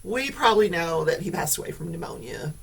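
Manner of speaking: ordinary delivery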